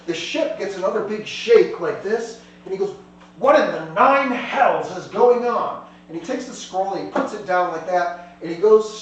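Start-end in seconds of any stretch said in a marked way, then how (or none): 3.4-5.9 loud, drawn-out exclamation; exasperated captain, raised voice; indignant outburst. Acted. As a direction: demand it slowly, punching each word. Captain startled as ship shakes.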